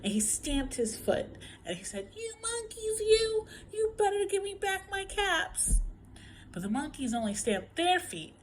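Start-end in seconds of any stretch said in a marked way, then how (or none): 2.1-5.8 very high-pitched scolding; quite angry. A narrator's reading. Peddler stamping his foot at monkeys, angry and high. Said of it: "Now he felt quite angry".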